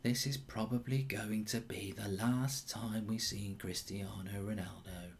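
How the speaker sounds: hushed, slow voiceover, sinking near a whisper; very soft, drawn-out solemn commentary; attempted impression. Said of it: "I can't do it".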